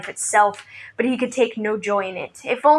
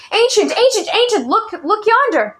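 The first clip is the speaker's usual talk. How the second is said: high, loud excited calling out; excited yell; 11 semitones higher, 4 dB louder than usual. A narrator's reading. Boy spotting a runner, calling the old man excitedly. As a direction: call out to the old man, thrilled. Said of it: "he let out a yell of excitement".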